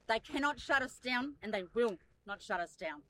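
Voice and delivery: ordinary talk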